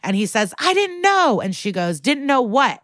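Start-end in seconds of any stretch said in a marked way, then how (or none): 0.6-1.4 high-pitched defensive cry; defensive protest. Retold or imitated. Witness defends himself against the lawyer's grilling, high defensive protest. 2.0-2.8 high, challenging comeback; pointed challenge. Retold or imitated. Lawyer presses the witness on the stand, pointed high challenge.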